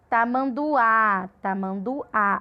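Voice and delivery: "tamanduá" slowly, opening wide on the last "á"; stress on final á. Shown as a demonstration. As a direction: stretch out the end of "tamanduá". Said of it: "abre mais a boca pra falar".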